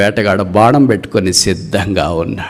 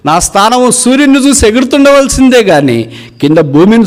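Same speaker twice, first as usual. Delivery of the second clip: much higher and louder wail; high wailing cry; 6 dB louder than usual. Acted. The eagle mourns its lost heights to the hunter, wailing high and loud. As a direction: cry it out like a grounded eagle longing for the sky.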